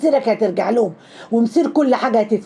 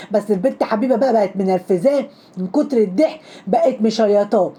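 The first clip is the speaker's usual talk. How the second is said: usual speaking voice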